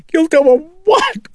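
squall it high and loud; frantic, screeched question; wild squall. A narrator's reading. Chris challenging the priest about guilt, squalling wildly. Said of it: "Chris squalled, her eyes wild".

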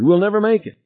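very high, panicky wail; panicking. A narrator's reading. Passenger to pilot as time runs out, high and panicky. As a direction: despair in a high frantic voice. Harry despairs before the explosion.

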